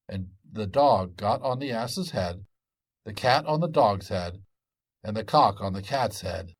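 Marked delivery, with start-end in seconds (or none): none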